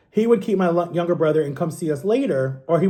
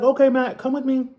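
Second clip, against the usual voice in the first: friendly doctor; 7 semitones higher than usual. Retold or imitated. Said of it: "he's like".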